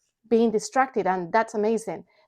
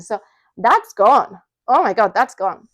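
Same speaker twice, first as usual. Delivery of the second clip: emphatic relief; 7 dB louder than usual. Real, not acted.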